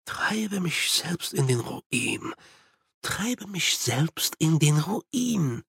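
slow, hushed muttering to oneself; half-whispered, pondering repetition; murmuring. A narrator's reading. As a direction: murmur it softly, dwelling on each word. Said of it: "murmelte er".

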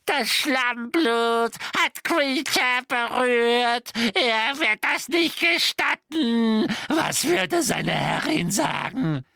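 shocked, offended house-elf; disgusted house-elf. Acted. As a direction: spit it out like a recoiling elf. Kreacher recoils in disgust.

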